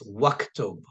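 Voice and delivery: waktub said as one word, the alif silent; waktub without alif. Shown as a demonstration. Teacher showing the alif is not pronounced. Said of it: "It's as if I said like this".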